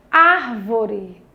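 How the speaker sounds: "árvore" with a long, strong first syllable; demonstrative ÁR-vore; stress on ÁR. Shown as a demonstration. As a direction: lean on ár, let vore drop away in "árvore". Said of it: "eu pronunciei com mais intensidade".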